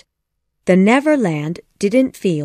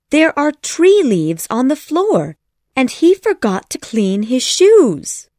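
put-on high, louder young girl's voice; excited yell; 7 semitones higher, 5 dB louder than usual. A narrator's reading. Wendy tells her mother about the leaves, high excited yell. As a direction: report it loudly in a high child's voice. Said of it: "she yelled to her mother".

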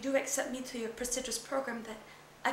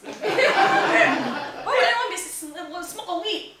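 laughing disbelief; 7 semitones higher, 10 dB louder, much slower than usual. Acted. Mother reacts, laughing.

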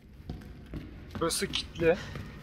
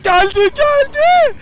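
much higher, slower, louder cry of panic; panicked shriek; 6 dB louder, slower than usual. Real, not acted. Player fleeing the zombie, panicked high shriek. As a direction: shriek as the monster catches up.

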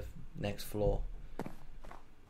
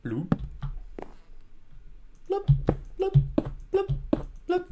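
ordinary talk, nothing marked

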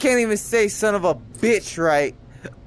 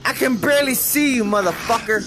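fed-up yell; 5 semitones higher than usual. Real, not acted. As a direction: snap at the enemy you can't see. Frustrated player yelling at enemy.

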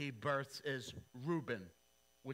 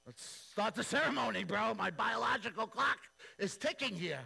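high and loud, pushy and impatient; nagging groom in a hurry; eager Jacob; 6 semitones higher, 4 dB louder than usual. Retold or imitated. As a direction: Jacob hurrying Laban to the wedding, higher and louder. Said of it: "and he's like".

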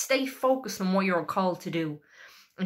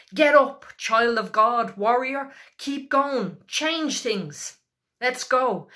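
urgent, higher-pitched rallying call, loud at the start; rousing exhortation; 4 semitones higher, 3 dB louder, slower than usual. Real, not acted. Preacher to someone stuck in self-pity, rousing exhortation. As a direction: spur them on in short punchy commands.

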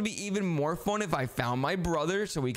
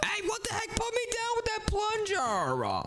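much higher, slower alarmed cry; panicked protest. Acted. Brother waving a plunger at him, squealing in panic.

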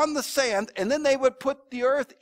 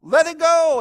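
firm urging; 8 semitones higher, 8 dB louder than usual. Retold or imitated. Pastor retells urging the widow.

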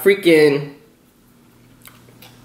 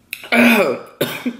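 coughing fit; 8 semitones higher than usual. Real, not acted. Coughing fit while eating.

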